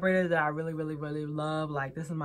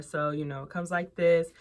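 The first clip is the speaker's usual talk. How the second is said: ordinary delivery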